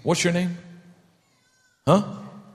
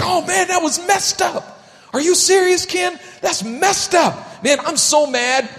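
high, loud, indignant shouting; hammed-up indignant yelling; mock outrage; 11 semitones higher, 4 dB louder than usual. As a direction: overplay the outrage at a stranger's wrong. Acted outrage over TV news.

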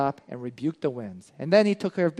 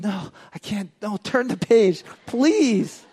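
put-on scared child; 3 semitones higher than usual. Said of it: "she's just like".

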